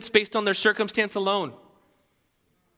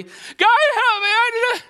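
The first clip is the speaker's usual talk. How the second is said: much higher, loud, hammed-up cry for help; mock desperate plea; 9 dB louder than usual. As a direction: cry out to God like a panicked believer.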